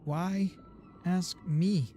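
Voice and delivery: ordinary delivery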